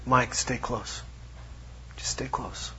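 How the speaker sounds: hushed, tender; quiet intimate voice of God; soft whisper from God. Retold or imitated. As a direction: whisper it gently.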